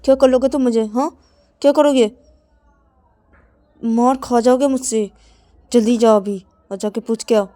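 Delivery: loud, taunting challenge; Ravi angry challenge. Acted. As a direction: dare him, then order him out. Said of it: "तो तभी रवि बोलते".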